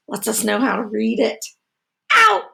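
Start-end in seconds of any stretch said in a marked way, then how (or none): 2.1-2.4 huge, high, loud shout. Acted. Bear ordering the guests out, shouting. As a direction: blast the word out. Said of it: "author and illustrator write some words".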